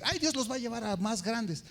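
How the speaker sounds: a hint of detached complaining; mock distancing. Shown as a demonstration. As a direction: say "los" like an outsider to the church. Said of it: "porque no puedo decir".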